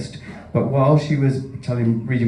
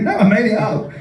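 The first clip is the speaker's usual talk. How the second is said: laughing; 10 semitones higher, 3 dB louder than usual. Retold or imitated. Retelling own reply, laughing.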